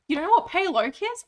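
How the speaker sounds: high, loud disbelief; can't believe they don't know; incredulous. Real, not acted. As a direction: pitch jumps up in disbelief. Incredulous at chat.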